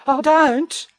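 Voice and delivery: high, anxious protest; alarmed cry. A narrator's reading. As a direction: cry out high to stop someone. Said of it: "cried Missus Bear".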